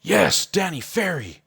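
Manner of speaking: high, drawn-out mock cheer; sarcastic excitement. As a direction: fake being thrilled, stretching the words. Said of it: "that's why I'm being so sarcastic here".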